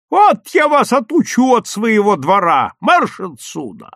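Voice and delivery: loud, high scolding yell; irate neighbour, slowing on the final order; angry shouting. A narrator's reading. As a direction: chase trespassers off with a shout. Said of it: "закричала она".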